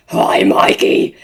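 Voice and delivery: low, loud growled greeting; growling. Retold or imitated. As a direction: growl the name like a monster. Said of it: "I growled at him".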